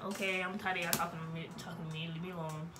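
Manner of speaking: quick soft grumble; fed up mutter. Retold or imitated. Tired patient grumbling at chatty relatives, soft and fast.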